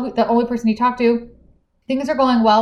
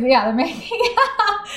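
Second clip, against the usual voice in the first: breaking into a laugh mid-phrase, pitched up; 9 semitones higher than usual. Real, not acted. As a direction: let the laugh spill into out. Still laughing about the kissing.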